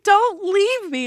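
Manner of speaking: high, slow, pleading mock whine; pleading with Jacob not to go, in a mock whine; whiny mock Bella. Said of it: "It's like".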